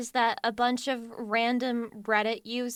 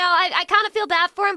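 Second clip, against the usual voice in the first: emphatic insistence; 5 semitones higher, 6 dB louder than usual. Real, not acted. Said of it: "Am I too empathetic?".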